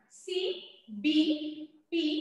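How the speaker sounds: CBP very slowly, letter by letter; spelling out letters. Shown as a demonstration. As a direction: spell out CBP with long gaps between letters. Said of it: "आप देख सकते हो spelling अलग है". (CBP का हर अक्षर अलग-अलग, धीरे)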